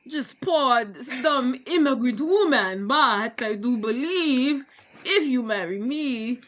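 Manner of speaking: hammed-up Russian accent with drawn-out phrases. She angles for William's proposal, mock Russian accent. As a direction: plead like a mock poor Russian immigrant. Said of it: "can you say it in her accent".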